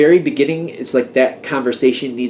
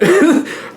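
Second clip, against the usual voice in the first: short high laugh at herself; laughing at how worked up she got; burst of laughter; 4 dB louder than usual. Real, not acted. Reader laughs at getting worked up, high burst of laughter.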